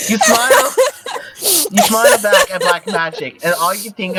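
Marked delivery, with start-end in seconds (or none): 0.1-0.7 high, loud, through real laughter; laughing. Real, not acted. Resuming the story after a slip, helpless laughter. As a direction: try to read while cracking up. Trying to resume while cracking up.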